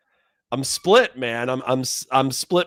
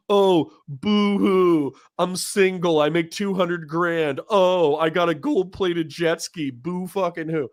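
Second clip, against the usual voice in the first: drawn-out, high mock boo-hoo; dismissive mock sympathy; 4 semitones higher, slower than usual. Host mimicking their old attitude to libertarians, mocking boo-hoo. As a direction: whine it mockingly, higher and slow. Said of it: "I think that was my attitude".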